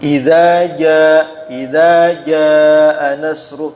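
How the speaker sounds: "jaa" with a breathy puff, drawn out very slowly; Javanese-coloured reading. Shown as a demonstration. Teacher shows the Javanese-coloured recitation. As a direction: stretch "Idza jaa nasru" slowly with air on the ja. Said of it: "kalau itu dialek-dialek Jawa".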